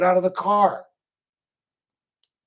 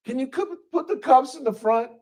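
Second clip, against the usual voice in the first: pleading arrestee; 6 semitones higher than usual. Retold or imitated. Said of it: "Number four".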